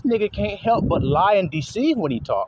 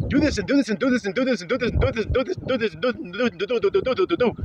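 fast mocking rattle; 4 semitones higher, faster than usual. Rapid mocking list of commands.

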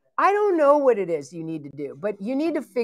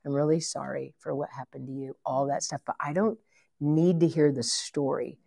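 said really lovingly; 7 semitones lower than usual. Shown as a demonstration. Demonstrating to the team how to gently refuse a sob story. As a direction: let them down kindly but firmly. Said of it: "here's what I say really lovingly".

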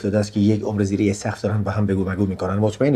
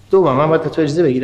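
indignant retort; 6 semitones higher, 4 dB louder, faster than usual. Acted. Heated quarrel with cousin.

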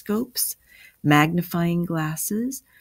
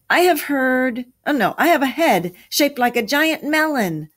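high, loud proclamation; dramatic, raised-voice declaration; loud pronouncement; 9 semitones higher, 5 dB louder than usual. A narrator's reading. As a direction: announce it in raised-pitch dismay. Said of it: "pronounced Jack".

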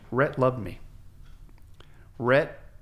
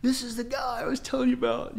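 much higher, as if off in the distance; heard from the background; 12 semitones higher than usual. Retold or imitated. Friend talking away from the dropped receiver, far off. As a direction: voice the friend heard faintly over a dropped phone. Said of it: "I can hear him in the background".

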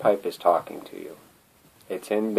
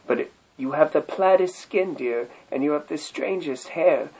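higher, sweetly pleading turtle voice; courting turtle; 6 semitones higher than usual. Acted. Turtle wooing the silent bagpipe, higher and sweetly pleading. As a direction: coax her like a lovesick turtle. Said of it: "Said the turtle to his darling".

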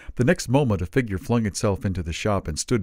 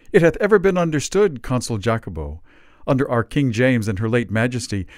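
louder, higher, bluff Elizabethan orator; hearty Ben Jonson; 5 semitones higher, 5 dB louder than usual. A narrator's reading. Ben Jonson declares to the company, hearty boom.

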